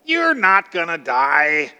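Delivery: slow, dismissive mock assurance; sarcastic, mistrustful. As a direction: draw it out, scoffing at the warning. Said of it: "hint of sarcasm and mistrust".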